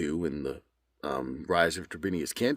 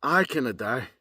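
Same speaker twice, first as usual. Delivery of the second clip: Scottish accent; 5 semitones higher than usual. Shown as a demonstration. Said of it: "Now as if I'm Scottish".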